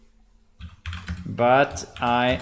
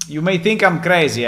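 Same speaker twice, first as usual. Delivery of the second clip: excited; 6 semitones higher, 7 dB louder, faster than usual. Acted. Hyping low prices to players.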